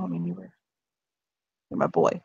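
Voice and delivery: soft, breaking with emotion; barely holding back tears; choked up. Acted. Jack tells Darcy he's his boy, choked up. Father tearing up to son.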